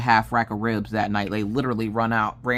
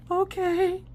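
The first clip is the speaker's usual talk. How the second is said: put-on much higher, slow child's voice; worried little girl. Daughter asking mom about dad, high worried child voice. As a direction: ask like a worried small child.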